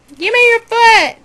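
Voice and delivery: high, loud command; loud demand. Real, not acted. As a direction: shout it at the stubborn bird. Bird won't give its foot.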